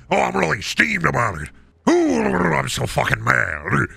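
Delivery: hammed-up steaming grump; fake-angry ranter. Red-pilled angry ranter persona.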